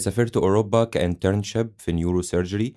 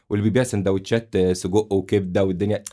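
usual speaking voice

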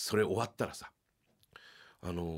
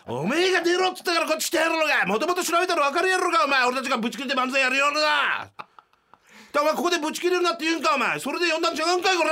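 high, loud furious shout; explosive rage; 8 dB louder than usual. Retold or imitated. Partner snapping at the producer in the hallway, furious shout. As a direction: blow up at the producer. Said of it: "ぶち切れたのよ".

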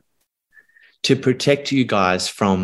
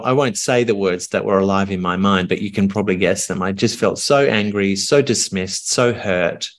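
ordinary talk

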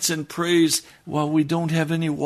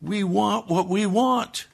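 slow, higher, bitter and stubborn; bitter demand; 7 semitones higher than usual. Acted. People complaining to God, slow bitter demand. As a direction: drag it out like a sulking people.

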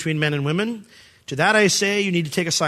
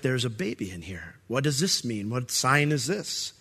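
much softer, lower, puzzled hush; hushed wonder; 6 semitones lower, 8 dB quieter than usual. Retold or imitated. Princess finds a baby in the box, hushed and puzzled. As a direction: marvel quietly at the baby.

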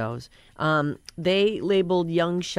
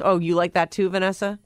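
normal talking voice

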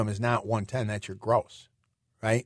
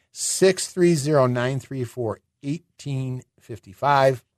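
"Six three zero, nine three four, eighteen fifty-five" said slowly, each digit spaced; slow and deliberate; slower than usual. Shown as a demonstration. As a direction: slowly spell out the number. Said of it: "phone number repeated slowly".

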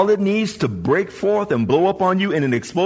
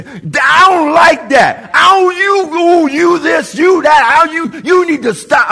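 high, loud, slow angry yelling; mock screaming; 10 semitones higher, 8 dB louder, slower than usual. A churchgoer screaming at Sister Ann, high furious yell. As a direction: scream it at her like you've lost it. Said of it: "I'm just screaming".

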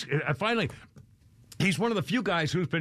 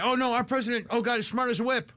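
mock gushing praise; 6 semitones higher than usual. Mocking Schumer praising president.

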